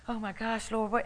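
high, startled gasp of words; mock astonishment; shocked. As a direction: act out being caught off guard. Preacher acts her own shock.

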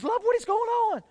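high, worried question; concerned wife. Retold or imitated. Wife woken at two asking her crying husband, high and worried.

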